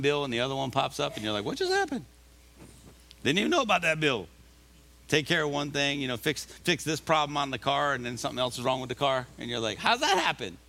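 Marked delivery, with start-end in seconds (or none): 1.4-4.3 much higher, fast, baffled squeak; mock bewildered surprise. Mock frustrated person bill surprise. 9.8-10.6 mock surprise. Mock car trouble surprise.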